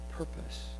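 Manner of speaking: whispered echo of "Purpose". Acted. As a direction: repeat "Purpose" as a soft whisper.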